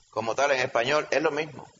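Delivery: ordinary talk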